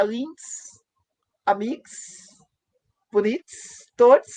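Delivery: "links, amigos, bonitos, todos" with the last vowel dropped, ending in consonant plus s; vogal final retirada. Shown as a demonstration. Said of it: "tira a vogal e coloca o S". ("links, amigos, bonitos, todos" sem a última vogal, só com s)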